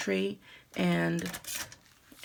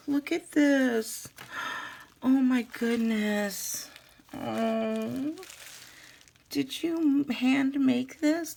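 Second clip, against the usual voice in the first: higher and slower, gushing over each detail; delighted surprise; 4 semitones higher, slower than usual. Real, not acted. Vlogger opening a viewer's handmade gift, slow delighted gushing. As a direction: marvel slowly at a handmade present.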